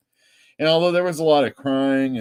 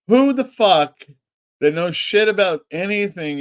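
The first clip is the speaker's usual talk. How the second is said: mock indignation; 8 semitones higher, 5 dB louder than usual. Indignant about comic claim.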